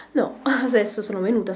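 ordinary talk

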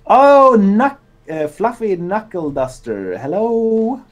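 loud, high, happy greeting; excited greeting. Real, not acted. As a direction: greet them with a burst of delight.

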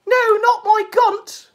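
very high, slow mock voice; high-pitched mock protest; bad Ethan Ralph impression. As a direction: mimic a failed impression, drawn out high. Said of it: "That's how he sounds when he's doing his Ralph impression".

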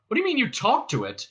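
high-pitched astonished demand; exclaiming. Acted. Ford demands Marvin explain himself, astonished. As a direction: blurt it in disbelief. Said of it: "exclaimed Ford".

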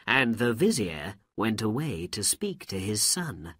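ordinary delivery

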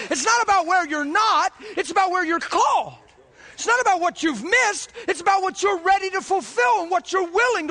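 rising preacher's cry; full-force preaching. Acted. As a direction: preach it out to lift the room.

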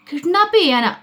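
shocked question. A narrator's reading. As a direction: ask it as if you can't believe it.

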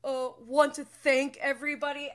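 hammed-up teary thank-you impression; mock award speech. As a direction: stammer through thanks like a fake award winner. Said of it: "as if he's, like, accepting an award".

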